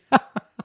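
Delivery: brief rueful chuckle; a quick laugh; little laugh at a rough month; short laugh. Real, not acted. Laugh after retelling bad January.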